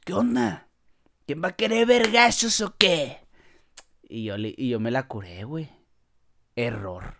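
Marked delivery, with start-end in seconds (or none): 0.0-3.2 mock tough-guy challenge, slightly higher; a streetwise thug picking a fight. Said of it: "Y dice el vato".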